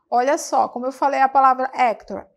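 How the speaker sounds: ordinary delivery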